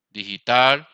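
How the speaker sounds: "digital" with a loud, punched final "tal"; stress on last syllable. Shown as a demonstration. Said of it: "tiene el acento en la última sílaba".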